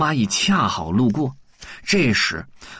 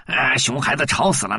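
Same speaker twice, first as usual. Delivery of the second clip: plain reading voice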